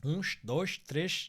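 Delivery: Rio-style "Uns, dois, três", final s said as sh; sh endings. Shown as a demonstration. Demonstrating how people from Rio count, sh-ending pronunciation. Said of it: "A lot of X in the end".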